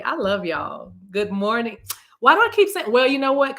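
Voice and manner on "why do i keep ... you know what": high, loud excited exclaim; excited outburst. Real, not acted. Asking the viewers, suddenly high and loud.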